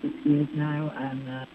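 echoey speakerphone in a car; distant, echoing phone audio. Real, not acted. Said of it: "It sounds like you're on a speakerphone in a gym".

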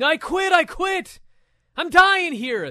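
high, loud hammed-up cries of surrender; mock screaming. Wrestler hanging in the ropes begging the ref to stop, mock screaming. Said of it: "you're screaming".

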